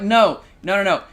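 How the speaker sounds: loud, emphatic repeated no. Real, not acted. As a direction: shut it down firmly. Emphatic rejection.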